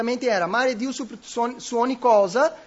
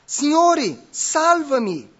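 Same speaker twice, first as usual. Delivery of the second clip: a high, loud cry of "Signore, salvami!" with "salvami" drawn out slowly; crying out for help; 6 semitones higher, 4 dB louder, much slower than usual. Acted. Peter calls out to the Lord as he sinks, loud, high and desperate. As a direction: Peter sinking in the waves, crying to Jesus. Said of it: "gridò".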